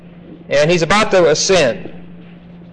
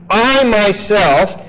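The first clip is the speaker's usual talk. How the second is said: I myself, slow and stressed on I; emphasis on I; 4 semitones higher, slower than usual. Shown as a demonstration. Teacher reading to class, heavy stress on I. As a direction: lean hard on I, slowly. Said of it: "with great emphasis on the I".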